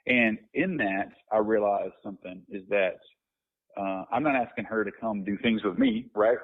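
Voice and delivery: ordinary talk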